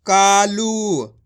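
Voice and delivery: कालू very slow, with the ऊ held long; drawn out. Shown as a demonstration. Teacher stretching कालू for learners. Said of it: "खींच के पढ़ना है".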